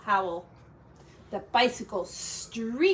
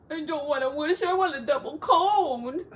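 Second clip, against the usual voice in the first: high, teary little pig whine; whimpering piglet; 5 semitones higher, 3 dB louder than usual. Acted. Garth whimpering to his siblings for ice cream. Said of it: "he whimpered".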